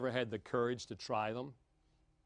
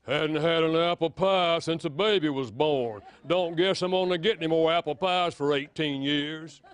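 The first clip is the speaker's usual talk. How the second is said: loud, sulky grumble about the missing pie; guilt-trip your spouse with a sour jab; hammed-up resentful gripe, louder than usual; demanding complaint; 2 semitones higher, 8 dB louder than usual. Shown as a demonstration. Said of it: "Love doesn't say".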